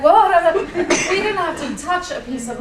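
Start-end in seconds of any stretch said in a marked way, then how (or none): 0.0-0.5 loud, higher-pitched warning yell; imitating a startled shout; retold alarm. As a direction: call out in mock alarm. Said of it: "every now and then he's like".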